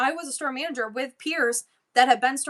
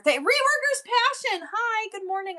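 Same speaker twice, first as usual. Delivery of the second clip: high-pitched happy hello; light up greeting a friend; sing-song good morning to a regular; excited greeting; 12 semitones higher than usual. Real, not acted. Greeting a viewer joining the stream.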